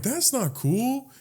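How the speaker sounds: slow, high, softened reproach; retold reproach. Sick man watching groper leave, slow high reproach. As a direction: draw it out high, quietly objecting.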